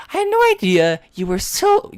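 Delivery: put-on high, sweet girl voice; Monica's voice. Shown as a demonstration. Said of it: "It was Monica, not me".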